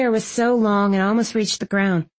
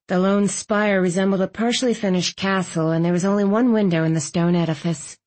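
plain reading voice

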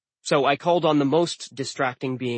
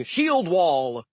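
higher, contained warrior's yell; mock battle yell; 4 semitones higher than usual. A narrator's reading. Imagined Viking rallying his fighters, a mild yell. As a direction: call out a battle command, not full force. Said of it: "some dude yelling".

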